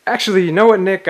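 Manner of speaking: high loud hurried excuse; nervous excitement. Acted. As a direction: bluff your way out. Hasty excuse.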